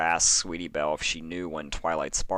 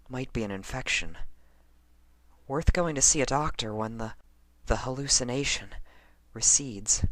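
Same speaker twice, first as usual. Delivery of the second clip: put-on higher, lighter young mare voice; Lyra's voice; 7 semitones higher than usual. A narrator's reading. Lyra reassuring herself, higher voice.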